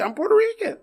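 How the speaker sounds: high, emphatic repeat of "I'm Puerto Rican"; insist, voice jumping up high; exasperated insistence. Retold or imitated. Third insistent repeat, much higher.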